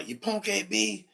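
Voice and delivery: student taunting the teacher from afar; insulting student. Retold or imitated. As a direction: imitate a kid yelling an insult. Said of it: "I heard".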